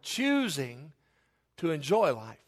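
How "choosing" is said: "choosing" stressed as a word on its own; emphasis on choosing. Real, not acted. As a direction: say "choosing" slowly and deliberately, set apart by pauses. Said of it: "I emphasize that word".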